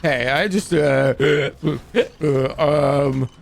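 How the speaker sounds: put-on a touch of a slurring drunk; lightly imitating a drunk man asking for help; drunk guy. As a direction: stumble through it like a tipsy guy. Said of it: "He's so drunk".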